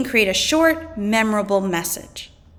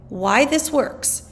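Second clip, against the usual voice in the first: ordinary talk, nothing marked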